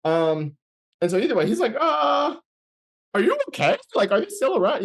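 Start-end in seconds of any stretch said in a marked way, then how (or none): 1.8-4.8 high-pitched, mildly alarmed questioning; retold concerned check. Friend reacting to his story, imitated high concerned voice.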